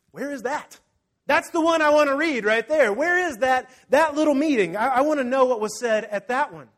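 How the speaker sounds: high, loud, insistent complaint; frustrated demand. Retold or imitated. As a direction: demand the missing story, exasperated. Acting out a reader demanding answers.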